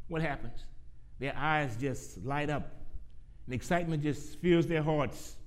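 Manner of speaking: lifted, projected pulpit voice; preaching. Real, not acted. Preaches an enthusiastic sermon opening.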